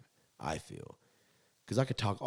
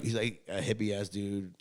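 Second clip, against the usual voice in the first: ordinary talk, nothing marked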